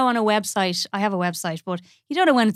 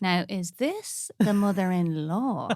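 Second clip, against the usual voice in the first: slow, low, confidential musing; nosy aside; 3 semitones lower, 6 dB quieter, much slower than usual. Retold or imitated. Stylist secretly working out the wedding family, slow hushed aside. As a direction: ponder it softly, as if secretly observing. Voices her nosy people-watching thoughts.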